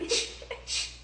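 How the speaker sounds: a whispered shh, shh; retelling the moment, hushing in a whisper. Retold or imitated. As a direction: hush them under your breath. Hushing while telling the story.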